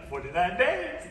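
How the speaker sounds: forty-nine days with a long rising swoop on "days"; eager anticipation. Retold or imitated. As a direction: imitate an eager groom, stretching "days" upward. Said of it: "he was like".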